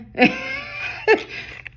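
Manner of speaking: shrieking high laugh burst. Real, not acted. Reader bursting into a high shrieking laugh.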